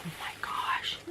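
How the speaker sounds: retold hushed amazement; soft, breath-only reaction; whispered astonishment. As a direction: whisper it, taken aback. Retelling astonishment at mother-in-law's accent.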